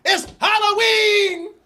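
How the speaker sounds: high, slow, overexcited sales pitch; hammed-up hawker. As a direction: hawk masks like a manic street seller. Street vendor hawking masks.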